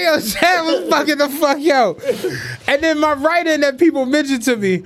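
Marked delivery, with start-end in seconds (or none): 0.0-2.0 slowed, cracking up mid-line; words squeezed out through laughter; laughing so hard it almost cries. Real, not acted. Laughing at the memory.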